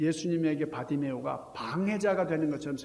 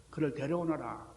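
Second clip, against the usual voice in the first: ordinary talk, nothing marked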